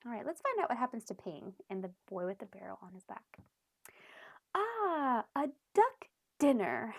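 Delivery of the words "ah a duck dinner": louder, pleased father's voice; a father spotting his dinner, louder; father glad. A narrator's reading. Father spotting the duck, loud and pleased.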